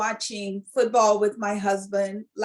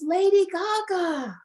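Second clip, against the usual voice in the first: high, slow, delighted naming of her; old man recognizing her; 9 semitones higher, slower than usual. Retold or imitated. Old singer greeting [name] on stage, slow delighted recognition. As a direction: imitate the old singer drawing out her name. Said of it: "he goes".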